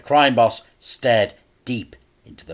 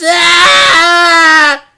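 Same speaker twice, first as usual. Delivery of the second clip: much higher, loud agonised scream; boy's scream; 16 dB louder than usual. A narrator's reading. Frank screams as his ears are crushed. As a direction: scream in pain. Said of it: "he screamed".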